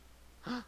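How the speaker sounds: quick surprised intake of breath; sharp gasp. Real, not acted. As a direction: gasp at the idea. Sudden idea gets a gasp.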